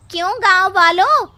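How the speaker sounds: loud, high call to the crowd; shouting out to the gathered villagers. Acted. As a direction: rouse the whole village. Rallying the villagers.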